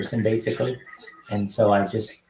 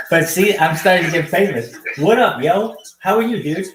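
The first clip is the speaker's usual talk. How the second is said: hyped host greeting; 7 semitones higher than usual. Acted. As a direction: greet strangers like a pumped-up show host. Hyped greeting to video-chat strangers.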